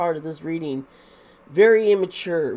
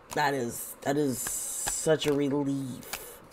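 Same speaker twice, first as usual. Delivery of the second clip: softer, slower sigh of relief; relieved; 3 semitones lower, 8 dB quieter, slower than usual. Real, not acted. Reading the cards for viewers, softer, slower and relieved. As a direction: let the relief settle into the words.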